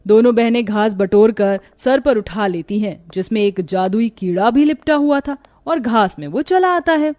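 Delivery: usual speaking voice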